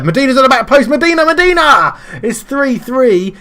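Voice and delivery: ecstatic goal call. Real, not acted. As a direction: scream the goal like a commentator. Calling a late equaliser.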